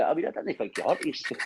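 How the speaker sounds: ordinary talk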